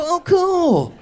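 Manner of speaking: high, sarcastic parroting of wife; mild sarcastic imitation; mocking echo. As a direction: mimic her words to mock them. Mocking wife's words back.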